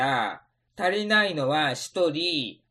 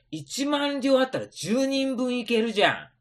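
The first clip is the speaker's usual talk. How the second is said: normal talking voice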